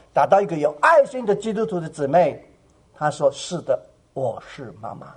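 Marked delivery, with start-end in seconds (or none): 3.3-5.1 soft tender reply. Retold or imitated. Sister comforts the lost girl.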